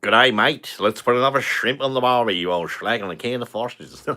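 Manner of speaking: a hammed-up Australian accent; bad Australian accent. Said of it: "I can't really do Australian".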